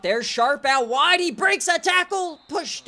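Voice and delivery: high, loud call of the catch; rising broadcaster excitement; excited call. Real, not acted. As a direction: lift the voice as he breaks free. Receiver breaks a tackle.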